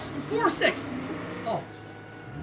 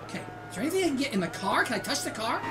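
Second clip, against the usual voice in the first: ordinary talk, nothing marked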